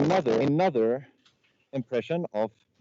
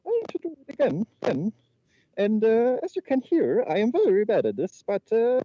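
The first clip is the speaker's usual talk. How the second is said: very high, louder mouse voice; Mickey Mouse; 11 semitones higher, 3 dB louder than usual. Acted. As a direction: push the cartoon mouse impression high and squeaky. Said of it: "now demonstrate the voice of Mickey Mouse".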